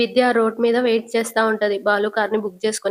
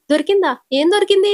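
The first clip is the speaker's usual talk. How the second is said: higher, louder alarmed question; surprised; 9 semitones higher, 5 dB louder than usual. Acted. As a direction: whip round, startled. Driver turns back, suspicious.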